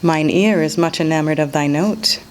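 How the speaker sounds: plain reading voice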